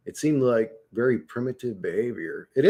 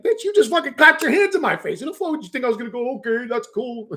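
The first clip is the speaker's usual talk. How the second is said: high, fast, outraged shout; outraged yell; 12 semitones higher than usual. Retold or imitated. Ranting at the coworker who clapped, high fast outraged shout. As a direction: let the disbelief explode, high and fast.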